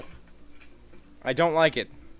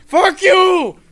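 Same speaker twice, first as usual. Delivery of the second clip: sudden yell; 12 dB louder than usual. Real, not acted. Sarcastic outburst at enemies.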